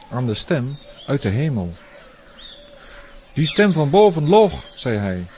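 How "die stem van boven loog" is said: high, loud wailing protest; anguished outburst. Acted. Tyrant blames the heavenly voice, loud anguished cry.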